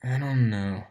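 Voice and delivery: put-on barely moving the mouth, bored teen mumble; a lightly voiced lazy teenager mumbling; mumbled. A narrator's reading. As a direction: mumble it through nearly closed lips. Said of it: "he said without moving his mouth much".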